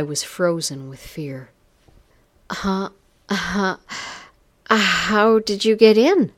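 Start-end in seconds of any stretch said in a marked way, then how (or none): 2.5-6.3 high, loud, stammered question; frightened stutter. A narrator's reading. Wife frozen with fear at the intruder, stuttering. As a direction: stumble into the question, then burst out higher. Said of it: "I stuttered".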